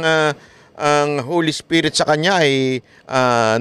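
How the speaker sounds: ordinary delivery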